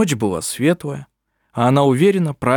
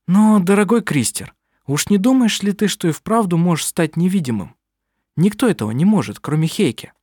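higher-pitched, lightly voiced doubting mother; sceptical mother; 7 semitones higher than usual. (слегка сыгранная недоверчивая мать)

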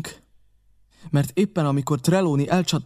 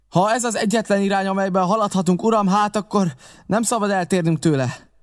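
higher-pitched firm reply; insistent captain; 6 semitones higher than usual. Acted. Captain answering the helmsman about the course, insistent and high. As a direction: insist in a raised, pressing pitch.

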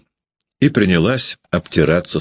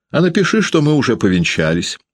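quickly; 9 semitones higher than usual. Acted. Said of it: "быстро сказал Передонов".

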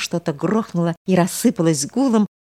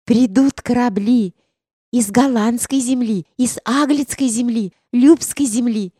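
put-on excited girl, louder and higher; eager young girl; 6 semitones higher, 4 dB louder than usual. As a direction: tell it like a thrilled village girl. Girl eagerly describes ships.

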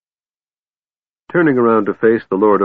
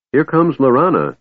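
relieved; 7 semitones higher, 3 dB louder than usual. A narrator's reading. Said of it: "Tass said thankfully".

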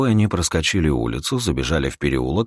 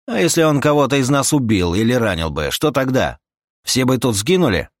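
heated rebuke in a raised pitch; exasperated companion; 6 semitones higher, 4 dB louder than usual. Acted. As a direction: argue him down forcefully. (раздражённая отповедь на повышенном тоне)

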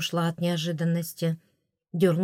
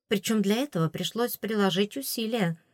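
usual speaking voice

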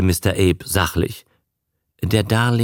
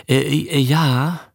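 unsicher; 7 semitones higher, slower than usual. A narrator's reading. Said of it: "sagte Abe unsicher".

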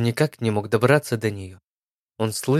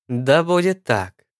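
put-on solemn old woman; 5 semitones higher, 3 dB louder, slower than usual. A narrator's reading. Crone seals the deal.

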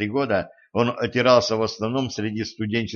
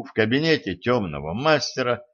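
ordinary delivery

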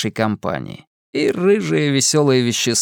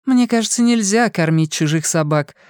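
put-on bubbly sorority girl; 7 semitones higher than usual. A narrator's reading. Fortuna voiced.

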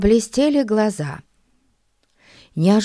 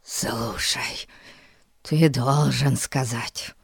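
breathless urgent whisper; out of breath whisper; 5 semitones lower, 4 dB quieter, slower than usual. Acted. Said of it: "задыхаясь, прошептал".

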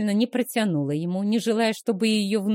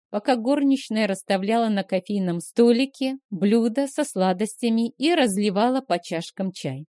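usual speaking voice

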